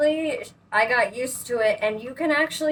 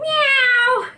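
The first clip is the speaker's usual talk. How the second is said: cat meow; 7 dB louder than usual. Pretend. Mocking the cat noise.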